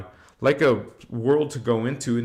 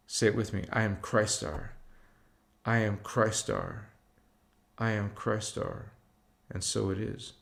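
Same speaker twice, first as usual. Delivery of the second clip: guided affirmation; 3 semitones lower than usual. Acted. A guide leading viewers in affirmations, growing hushed.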